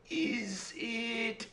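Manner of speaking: high, soft, drawn-out princess voice; hesitant princess guessing. Acted. Princess guessing the imp's name, high and hesitant. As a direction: stretch it out high and tentative.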